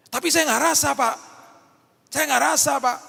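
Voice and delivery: high, loud whiny protest; complaining believer. Retold or imitated. Believer whining to the pastor, high and loud. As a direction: whine that you just don't feel it.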